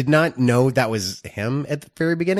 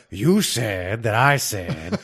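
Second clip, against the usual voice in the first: Al Pacino impression; 2 semitones lower, slower than usual. Shown as a demonstration. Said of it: "That's how I do it".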